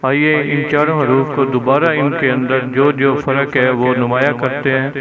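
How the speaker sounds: normal talking voice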